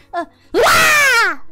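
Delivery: exaggerated wailing cry; hammed-up grateful bawl; loud "wah". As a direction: belt out a mock-crying wah. Cries out to show gratitude.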